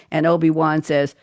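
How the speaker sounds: ordinary talk, nothing marked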